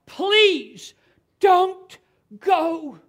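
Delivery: loud, high, desperate pleading; begging and crying. Acted. Friends begging Paul not to go to Jerusalem, crying out loud. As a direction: beg him at full voice not to leave. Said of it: "they're begging and they're crying".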